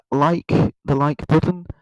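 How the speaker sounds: usual speaking voice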